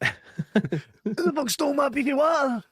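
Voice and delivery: high-pitched, drawn-out mock fury; mock outraged yell. Host hamming up an outraged colleague, high mock yell. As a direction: yell it like your lunch was stolen.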